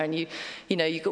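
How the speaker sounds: normal talking voice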